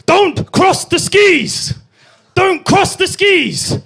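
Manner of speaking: high, slow, loud yell; instructor's shout. Retold or imitated. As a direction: bark a warning across the slope. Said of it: "kept just shouting at me".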